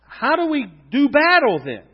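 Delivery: high, loud rallying question; preaching question. Acted. Preacher rallying the congregation, loud question. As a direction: call it out like a battle cry.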